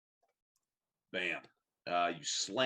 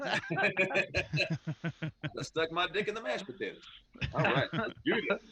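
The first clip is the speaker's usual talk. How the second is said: laughing; 10 semitones higher, 3 dB louder than usual. Real, not acted. Joking crude quip, laughing.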